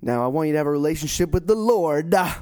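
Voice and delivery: loud, hammed-up Southern preacher cadence; mock preacher. Co-host teasing the pastor about his preaching, loud mock preacher. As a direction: ham up a revival preacher's sentence ending. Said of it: "if you did the thing where you".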